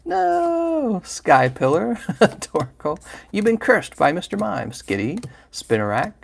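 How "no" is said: much higher mock wail; hammed-up squeaky no; anguished no. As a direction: squeal it like it hurts. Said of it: "He goes".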